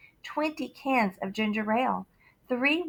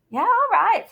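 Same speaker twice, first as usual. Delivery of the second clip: high, loud easy-going yeah; lightly voiced cop; policeman agreeing; 11 semitones higher, 6 dB louder than usual. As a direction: give in cheerfully.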